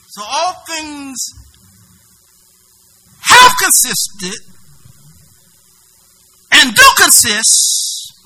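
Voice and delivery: each word slow and loud; slow emphatic preaching. Real, not acted. Preacher stressing Christ holds all things, slow and loud. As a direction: lay it down word by word.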